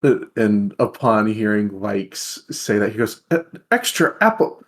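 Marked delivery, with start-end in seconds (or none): 3.3-4.6 much higher and louder, playfully aghast protest; mock exasperation. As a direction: whine in comic disbelief. Tavrik protests the extra apples.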